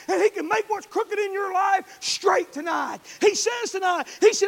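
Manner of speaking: loud, high, driving preacher's cry; preaching at full force. Acted. Pastor shouting the gospel to his church, full force. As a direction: preach it hard to the congregation.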